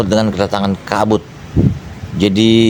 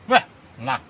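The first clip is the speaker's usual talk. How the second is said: ordinary talk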